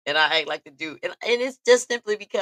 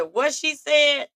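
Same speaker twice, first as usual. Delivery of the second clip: mock boyfriend; 10 semitones higher, 4 dB louder, slower than usual.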